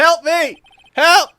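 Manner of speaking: high, loud mock plea; acted cry for help. Character calling for help, high shouting. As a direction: yell for rescue, hammed up.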